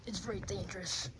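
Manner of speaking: hushed ominous whisper; whispered warning. Acted. Toy warns friends of the gigantic fan, whispering. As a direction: warn them under your breath.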